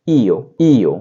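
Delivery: ईओ said as two vowels, ई then ओ; teacher demonstrating Italian io; Italian pronunciation. Shown as a demonstration. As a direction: model ईओ carefully. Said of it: "बोलेंगे इसे".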